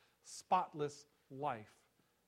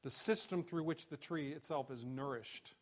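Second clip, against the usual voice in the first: ordinary talk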